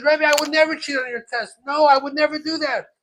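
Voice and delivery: loud, high-pitched denial; indignant protest. Retold or imitated. Accused pupil denying it to rebbe, indignant.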